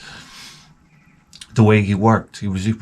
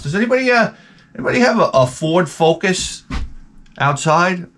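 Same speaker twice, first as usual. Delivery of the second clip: higher-pitched call-out to the room; coworker asking around; 7 semitones higher than usual. Retold or imitated. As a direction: lightly imitate someone asking around a warehouse. Imitates staff asking around the warehouse.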